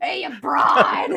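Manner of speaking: loud, slow, laughing "Hey, you broad"; laughing mock shout. Nurse jokingly shouting at an ER patient. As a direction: bellow it slowly, cracking up. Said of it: "I'll remember that for the next time in the E R".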